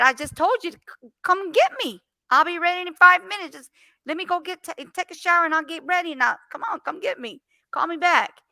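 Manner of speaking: high, loud taunting dare; high mocking dare to a scam caller. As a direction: challenge him to come and get you.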